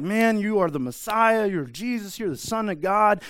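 high, loud eager praise; excited Peter. Retold or imitated. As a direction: gush like a thrilled disciple. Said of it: "it's like".

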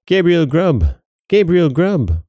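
high, wailing acted cry; chorus of voices. A narrator's reading. Goblin voices reply to question, high chant. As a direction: chant the name, swooping down. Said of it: "the voices replied".

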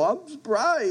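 high-pitched mock surprise. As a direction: play at being surprised, pitch jumping up. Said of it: "show up there and go".

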